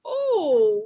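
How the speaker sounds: ordinary talk, nothing marked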